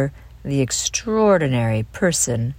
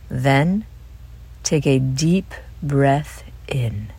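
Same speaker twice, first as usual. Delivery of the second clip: guided breathing. Acted. Host leads children through dolphin breathing, calm guided meditation. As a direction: lead each breath calmly, leaving room to breathe.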